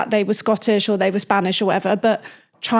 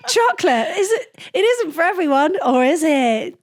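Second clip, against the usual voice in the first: much higher, goofy chocolate advert voice; silly ad voice; 7 semitones higher than usual. Shown as a demonstration. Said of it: "I can do silly as well".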